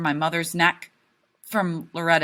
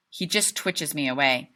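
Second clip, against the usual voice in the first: ordinary delivery, nothing marked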